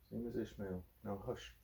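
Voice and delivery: very soft, near-whispered lull; soft bedtime hush. Real, not acted. Dad softly lulling his child to sleep, hushed. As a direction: murmur him toward sleep.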